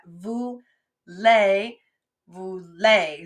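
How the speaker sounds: vous and lay pulled apart; split into sounds. Shown as a demonstration. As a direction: say vous, then lay, as two separate sounds. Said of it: "it has sort of the same sound".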